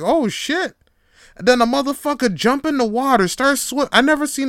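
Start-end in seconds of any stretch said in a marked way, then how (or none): 0.0-0.8 high startled exclamation; shocked oh shit. Retold or imitated. Watching the brawl video, a high shocked exclamation. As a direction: jump up in pitch, caught off guard. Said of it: "I'm just like".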